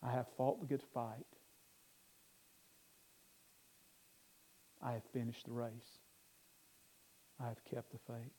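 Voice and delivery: soft, low scripture recitation with long pauses; grave, hushed closing words; solemn hush. Real, not acted. Solemn scripture before closing prayer.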